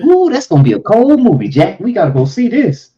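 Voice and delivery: high, loud movie hype; excited, swooping exclamation; excited reaction. Retold or imitated. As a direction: whoop it up like a thrilled moviegoer. Acts own excitement at supposed movie.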